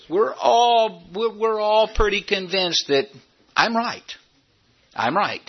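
mock smug certainty. Pastor mocking the congregation's self-righteous sense of justice, smug. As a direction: ham up the know-it-all who is sure he's right.

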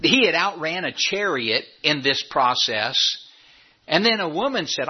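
usual speaking voice

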